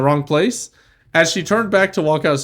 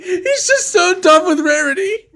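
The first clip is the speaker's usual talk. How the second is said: mock crying; 3 dB louder than usual.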